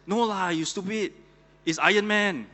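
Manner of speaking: high, loud mock-exasperated punchline; acted, hammed-up indignant outburst; exasperated scolding. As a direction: shout the correction at a fool. Superman scolds Batman, punchline.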